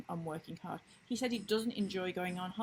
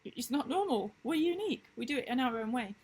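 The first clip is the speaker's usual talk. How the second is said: excited retort; 6 semitones higher, 3 dB louder than usual. Retold or imitated. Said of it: "It's like".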